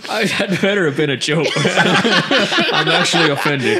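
high, laughing disbelief; incredulous, cracking up as you speak; laughing protest. Real, not acted. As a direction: protest through real laughter. Laughing at being told of lookalike.

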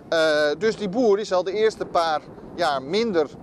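ordinary delivery

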